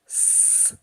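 long soft s hiss; pump hiss. Shown as a demonstration. Teacher demonstrates the pump's song. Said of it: "пісня насоса — це звук".

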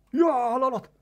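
high, loud whoop at a bite; excited yell. Real, not acted. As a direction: burst out in sudden excitement. Sudden bite.

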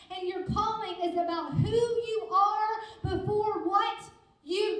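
loud, high, emphatic sermon delivery; preaching. Real, not acted. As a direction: preach it hard, driving each phrase home. Preaches at full force.

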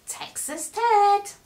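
slow, high and loud reveal of the name; excited reveal. A narrator's reading. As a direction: announce the find with delight. Reveal of found toy.

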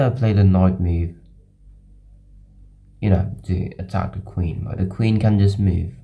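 normal talking voice

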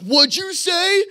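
loud, very high, drawn out; mock outraged challenge. Night watchman turns on trash-talking boy, imitated loud outrage. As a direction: stretch it out into a rising, affronted challenge.

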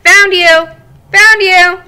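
loud, high "Found you!"; triumphant gotcha. Acted. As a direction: call out like winning hide and seek. Shell finding hidden eggs.

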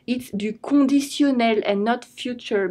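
ordinary talk, nothing marked

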